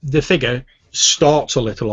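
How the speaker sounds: ordinary talk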